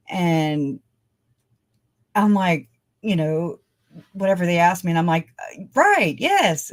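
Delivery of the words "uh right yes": higher, overwhelmed answer; high pitch. Retold or imitated. Overwhelmed driver answering two cops, high-pitched. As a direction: voice jumping up under questioning. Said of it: "I just have a high pitch".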